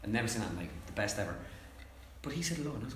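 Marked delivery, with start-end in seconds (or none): none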